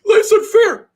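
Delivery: high, loud hammed-up whine of a crying player; mock crybaby whine. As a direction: whine it like a big baby, over the top. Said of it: "they're crying in the dugout".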